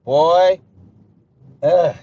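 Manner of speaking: annoyed at the trolls' question; exasperated groan. Real, not acted. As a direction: groan it out in disgust.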